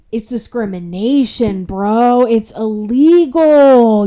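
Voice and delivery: loud, building indignation with drawn-out last word; exasperated protest. Retold or imitated. Applicant telling the manager it's discrimination, exasperated. Ranting at interviewer about discrimination.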